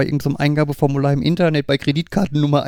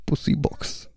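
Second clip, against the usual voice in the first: whisper; 7 dB quieter, slower than usual. Real, not acted. Whispered joke.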